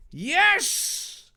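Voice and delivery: very high, thrilled cry; high excited yell. Acted. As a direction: cheer like a kid. Dave yelled out.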